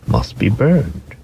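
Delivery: usual speaking voice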